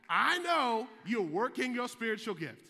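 high, loud, shouted preaching climax; preaching at full force. Acted. Pastor roaring hope at his church, shouted high and loud. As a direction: ride the crescendo and shout the promise home.

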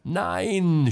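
high, loud cry of no; anguished scream. A narrator's reading. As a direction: scream in despair. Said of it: "schrie sie".

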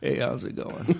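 casual middle-aged guy; easygoing dad-type greeting; middle-aged man voice. Shown as a demonstration. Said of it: "That's more middle-aged man".

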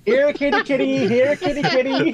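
high sing-song cat call; mock cat-calling. Teasing the hat wearer as cat bait, mock cat-calling.

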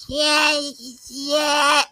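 exaggerated caricature of how men sound; imitating a man. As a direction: do a mock man's voice. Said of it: "I'm imitating a man and I, my voice goes like".

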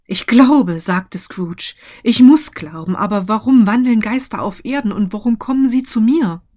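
anxious pleading. A narrator's reading. Scrooge pleads with ghost.

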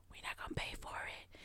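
hushed, nervous whisper about not paying; scared whisper. Retold or imitated. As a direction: whisper it so nobody in the store hears. Whispering in the store aisle.

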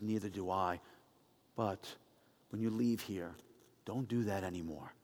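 quiet mercy without judgment; tender forgiveness. Acted. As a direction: let her go gently. Jesus forgives the woman.

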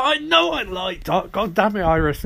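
high-pitched mock exasperation; exasperated admission. Barry fed up with Iris's accusations, hammed-up exasperation. Acted quarrel, Barry admits lying.

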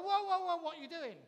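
mock the locals stopping the builders in alarm. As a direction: locals calling out to stop the rebuilding, alarmed. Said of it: "the locals go".

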